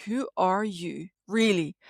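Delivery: ordinary talk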